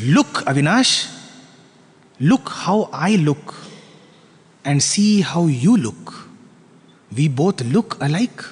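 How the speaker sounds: king gently showing his reflection; gentle revealing. Acted. King shows reflection in pond.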